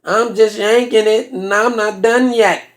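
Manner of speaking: slow, drawn-out character voice; brother's put-on voice. Brother answering his sister from the next room, slow put-on voice. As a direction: drag out each word as the brother. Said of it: "I hear somebody reply to her".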